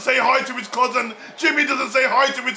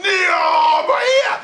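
giddy fan cheering the face-off; laughing excitement; 7 semitones higher than usual. Real, not acted. Two favourite wrestlers face off.

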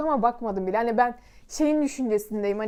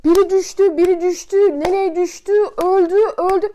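panicked shout, higher and louder; 5 semitones higher, 8 dB louder than usual. Retold or imitated. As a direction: yell it across a building site. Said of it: "abiler böyle bağırıyor".

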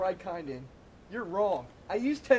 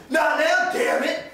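angry shout; 7 semitones higher, 6 dB louder, slower than usual. Acted. Dad snaps at kids.

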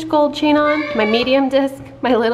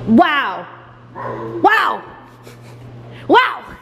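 rising wows, each one higher; excited wow; 4 semitones higher, much slower than usual. Acted. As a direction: build up wonder for the baby. Reading picture book to toddler.

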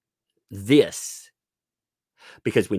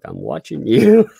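louder playful mock warning; imitating the child; 3 semitones higher, 10 dB louder than usual. Parent retelling child's watching gesture, mock warning. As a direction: mimic a kid's teasing threat. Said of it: "She's like".

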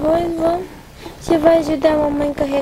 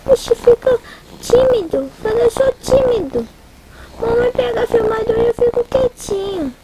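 put-on lightly raised playful baby talk; baby's voice; 9 semitones higher than usual. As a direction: speak for a shy baby, teasingly. Mother voices her shy baby.

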